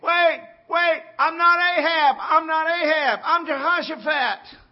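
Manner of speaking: high, loud frantic shout; screaming for his life to be spared; a king yelling in terror; panicked yelling. Retold or imitated. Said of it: "starts yelling".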